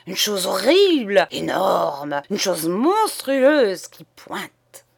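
sputtering in scandalized agitation; violently agitated. Acted. Nun describing the indecent statue to the priest, agitated. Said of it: "en proie à une agitation violente".

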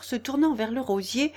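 ordinary delivery

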